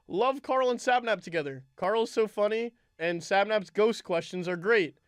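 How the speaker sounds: upbeat read-aloud voice; review read-out. Acted. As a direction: read it like an announcer. Reading out listener review.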